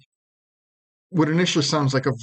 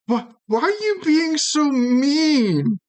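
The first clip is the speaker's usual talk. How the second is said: much higher, slow hurt whine; whiny guard; 13 semitones higher, 3 dB louder, slower than usual. Acted. Guard complaining that Romeo is mean in a high whine. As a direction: plead like a wounded child. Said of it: "the guards are like".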